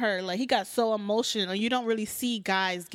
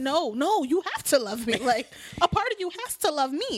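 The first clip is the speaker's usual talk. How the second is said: raised, higher-pitched mock pleading; mock insistent; 6 semitones higher than usual. Woman insisting that he has to love her, mock insistent. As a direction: lightly act out a lover's protest.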